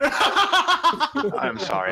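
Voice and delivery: laughing. Real, not acted. Laughing apology.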